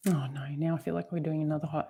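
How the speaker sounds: very soft, near-whispered protest; quick, low aside under the breath; reluctant murmur. Real, not acted. To the coach who forbade laughing, reluctant whispered aside.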